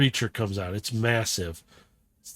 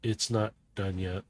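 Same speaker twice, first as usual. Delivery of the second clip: low, soft, quiet threat; ominous villain; 4 semitones lower, 8 dB quieter than usual. Acted. Carbine watching the experiment, low quiet menace. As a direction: say it under the breath as a menace. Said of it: "you hear Carbine".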